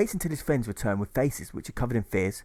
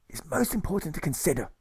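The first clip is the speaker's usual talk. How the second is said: pompous colonel, pitched up; a lightly raised blustering officer voice; 8 semitones higher than usual. Acted. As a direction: play the rambling, self-important colonel. Colonel's voice in story.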